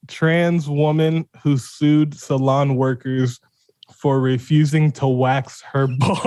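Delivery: slow, deliberate headline read, cracking at the end; holding back laughter. Real, not acted. Host reading the headline to co-host, fighting off laughter. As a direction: read it carefully, trying hard not to laugh. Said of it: "I tried so hard".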